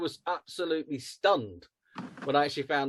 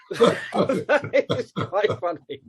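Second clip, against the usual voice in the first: laughing through the words, slow and loud; 5 semitones higher, 9 dB louder, much slower than usual. Real, not acted. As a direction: crack up while saying it. Laughing at the Arris joke.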